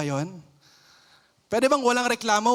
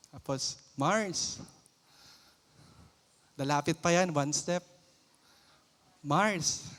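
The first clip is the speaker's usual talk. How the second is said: gossiping neighbor; 6 semitones lower, 4 dB quieter than usual. Acted.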